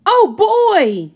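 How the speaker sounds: high, loud eager cry; delighted. Acted. Wendell thrilled by firefighter game, high eager cry. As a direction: jump at the idea.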